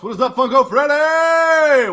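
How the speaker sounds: big, high, loud hail; hollered greeting. Real, not acted. Streamer welcoming Funko Freddy to chat, hollered greeting. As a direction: holler it across to a friend.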